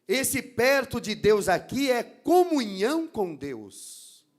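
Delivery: loud, emphatic sermon delivery building in force; forceful preaching. Real, not acted. Preacher warning the church about the enemy of God, forceful preaching. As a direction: preach it out loud to the congregation, driving each point home.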